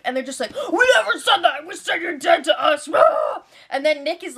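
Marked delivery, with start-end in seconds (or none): 0.7-2.9 mock aggressive outburst, pitched up, with a shout at the end; hammed-up angry yell at a housemate; aggressive brothers. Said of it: "They get so aggressive". 2.9-3.4 sharp high shriek of disbelief; shocked shriek. Real, not acted. Reacting to the brothers' outburst.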